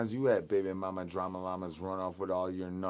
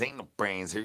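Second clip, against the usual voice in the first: usual speaking voice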